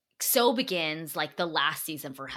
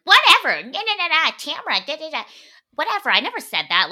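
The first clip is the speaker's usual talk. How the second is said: much higher, much louder, mocking dismissive brush-off; mocking Kelly; 13 semitones higher, 12 dB louder than usual. Host mimics Kelly brushing off the fight, much higher and louder. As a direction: toss it off like a careless airhead who denies everything. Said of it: "she's being a fucking nut".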